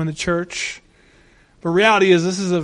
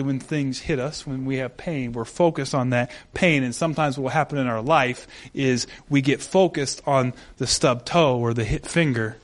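ordinary delivery

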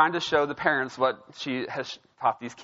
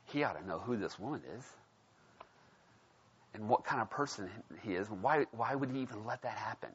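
much softer, lower scornful aside; disdainful muttering; 5 semitones lower, 8 dB quieter than usual. Retold or imitated. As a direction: mutter it like a smug Pharisee. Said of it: "he's like".

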